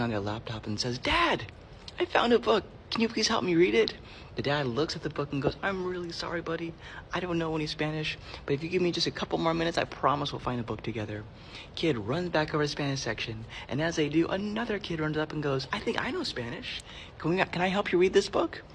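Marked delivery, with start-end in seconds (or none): 1.0-3.9 put-on high little-kid voice, rising; excited little kid. Kid asking dad for help reading, high and eager. 5.6-11.2 lightly voiced apologetic dad, higher pitched. Dad apologizing to his kid, lightly voiced and apologetic. 15.7-18.6 put-on high, hopeful kid voice; eager kid. Kid offering to help another read, high and eager. Second child voiced in a story.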